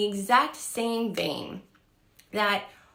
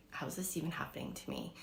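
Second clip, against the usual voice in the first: hushed, breathless disbelief; awed hush; 2 semitones lower, 14 dB quieter, faster than usual. Retold or imitated. Recalling the date, a hushed awed thought. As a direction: whisper it to yourself in awe.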